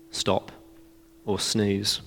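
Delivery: very soft, half-awake words; barely bother, as if silencing an alarm; sleepy mumble. Pretend. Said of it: "little bit sleepy".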